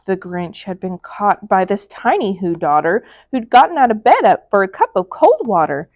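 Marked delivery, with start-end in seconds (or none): none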